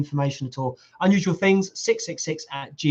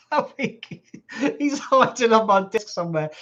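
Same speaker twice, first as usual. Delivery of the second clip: laughing; 6 semitones higher than usual. Real, not acted. Teasing Lee about his height.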